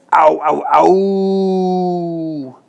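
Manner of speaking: high, loud yelps rising; wolf screaming in the boiling pot. Acted. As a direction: yelp in pain like a burnt wolf. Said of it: "screamed the wolf".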